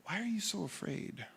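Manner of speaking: soft, tender voice; Jesus gently asking his scared friends, softly; gentle question. Retold or imitated. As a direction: ask it kindly and quietly. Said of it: "turns to his friends and says".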